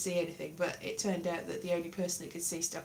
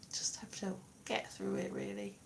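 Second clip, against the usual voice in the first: whisper; 9 dB quieter than usual. Real, not acted. Whispered resignation.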